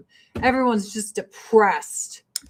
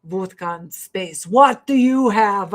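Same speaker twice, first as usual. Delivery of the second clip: Russian hosts bragging about space vodka, in a mock Russian accent; 2 semitones lower than usual. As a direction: drag out the words like a proud Russian host. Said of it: "they're like".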